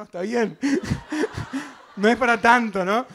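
loud, amused mock placating; laughing. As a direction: laugh through a retold nervous plea. Joking plea, laughing as he retells.

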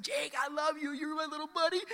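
high, held-back imitation of yelling; high-pitched doting dad holler, toned down; mock yell. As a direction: pretend to holler affection without full volume. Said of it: "I just yelled as loud as I could".

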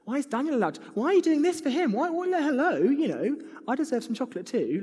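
put-on high, whining child complaining to Mum; a five-year-old wailing that it's not fair; whiny five-year-old. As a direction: whine like a jealous little kid. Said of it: "read this older brother narrative with my voice going".

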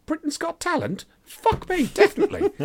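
high, squealing fake surprise; squeaky mock disbelief.

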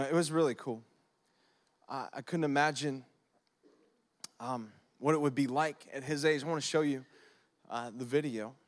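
ordinary talk, nothing marked

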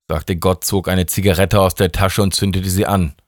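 ordinary delivery

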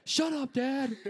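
high, teasing retort; mock snap at dad. Son in the car telling his teasing dad to shut up, high and mock-annoyed.

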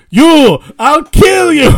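high, loud, drawn-out threat; play-acted enraged yell; hammed-up fury. Dropped guy threatening Rango, mock furious yell. Imitating character mad at Rango.

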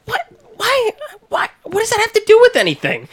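high-pitched incredulous questioning; exasperated bafflement. Retold or imitated. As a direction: squeak out the disbelief, speeding up at the end. Ranting, retelling reaction to the twist.

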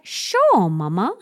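put-on cute copied reply of a toddler; toddler's sing-song sure. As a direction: mom mimics daughter's chirpy yes. Mom voices daughter's reply.

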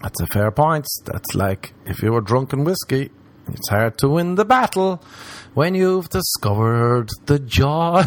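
usual speaking voice

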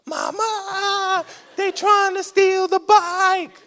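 put-on a scared kid calling grandma for help; panicked kid yell. Kid yelling bike being stolen.

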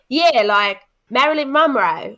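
Australian woman, insisting brightly; Australian accent. Retold or imitated. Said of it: "a little bit of an Aussie accent from you there".

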